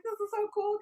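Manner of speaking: very high imitated gushing; desk lady's delight. Voicing the front desk lady.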